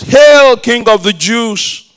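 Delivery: loud, high, slow sarcastic hail; mocking salute. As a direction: jeer like a soldier mocking a king. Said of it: "that is mocking him".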